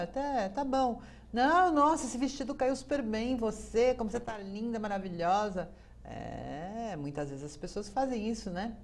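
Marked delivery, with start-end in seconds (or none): 0.0-5.7 high, loud effusive praise; gushing fake compliment. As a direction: lay on the compliments thick. Elogio falso carinhoso. (elogio exagerado e falso)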